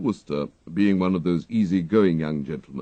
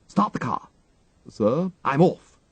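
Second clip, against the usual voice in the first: put-on higher, urgent young gentleman; perturbed Wooster; 7 semitones higher, 4 dB louder than usual. A narrator's reading. Wooster orders his valet to start the car, perturbed toff. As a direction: snap out orders in a flap. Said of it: "perturbed, deeply so".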